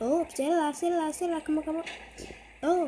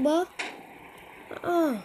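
ordinary talk

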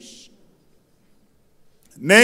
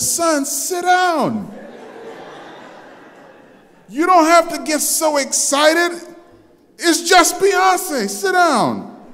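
high, slow mock-stern shout; hammed-up father telling his son to sit; scolding dad; 11 semitones higher, 3 dB louder, slower than usual. Father hushing his starstruck son, mock-stern shout. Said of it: "I said".

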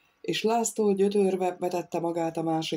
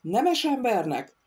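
csodálkozva; 6 semitones higher, slower than usual. A narrator's reading. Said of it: "kérdezte elcsodálkozva".

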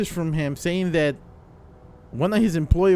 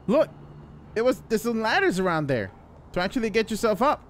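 ordinary talk, nothing marked

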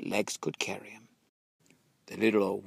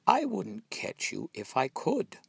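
polite fox; 12 semitones higher than usual. A narrator's reading. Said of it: "said the fox politely".

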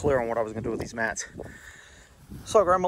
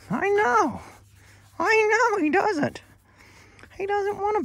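put-on baby talk to pet. Dad coos to his pet about the beating, high baby talk. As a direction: coo at him like a baby.

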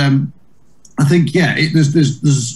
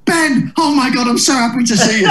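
relieved excitement; 10 semitones higher than usual. Retold or imitated. Eddie relieved to see Ben arrive, high happy relief. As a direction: greet him like a rescuer, pitched up. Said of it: "Eddie was like".

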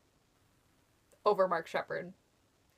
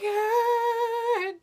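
very high, loud squeal; overwhelmed squeal; 13 semitones higher, 4 dB louder than usual. Retold or imitated. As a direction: squeal like your idol is right in front of you. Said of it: "and so I was just like".

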